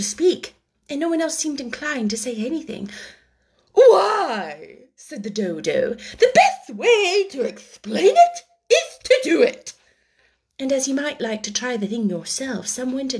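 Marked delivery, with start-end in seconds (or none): 3.7-4.9 high, loud, grand; Dodo's why. A narrator's reading. The Dodo begins explaining the race, grand. 6.2-9.7 high, loud, grand; Dodo's why. A narrator's reading. The Dodo begins explaining the race, grand.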